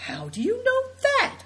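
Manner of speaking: high, astonished outburst; a girl blurting out in amazement; astounded. A narrator's reading. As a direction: gasp the question in disbelief.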